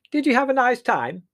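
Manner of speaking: high, loud, oblivious cheer; owlish aunt. A narrator's reading. Aunt Fan waking suddenly to greet them, owlish. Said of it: "waking suddenly and beaming at us owlishly".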